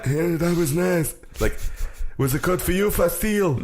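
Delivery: a bad high imitation of the actor; Christopher Lambert impression. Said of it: "I can't do Christopher Lambert".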